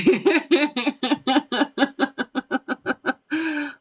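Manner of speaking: high embarrassed laugh. Real, not acted. Streamer laughs after blasting a viewer's earbuds, embarrassed laugh. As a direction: laugh it off sheepishly. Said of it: "I'm sorry".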